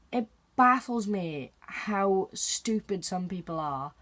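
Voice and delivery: ordinary delivery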